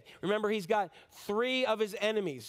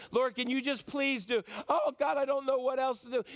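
raised-pitch helpless plea; implore, as if out of options; acted desperate prayer; begging God; 5 semitones higher than usual. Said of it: "where you beg God".

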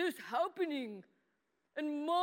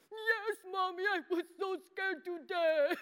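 hammed-up tearful wail, pitched up; higher, exaggerated boo-hoo voice; mock crying; 3 semitones higher than usual. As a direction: wail it like a little kid pretending to cry. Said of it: "No, I cried. I cried real bad".

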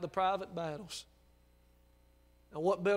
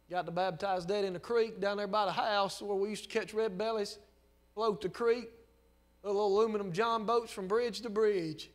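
ordinary delivery

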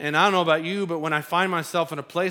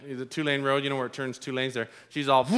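ordinary delivery

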